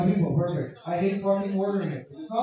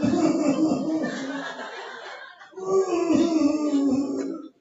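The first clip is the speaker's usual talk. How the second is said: strained wheezy stifled cough; mock stifled cough; held-in cough laugh; 9 semitones higher, 3 dB louder than usual. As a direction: choke a cough back, laughing. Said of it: "And I was like".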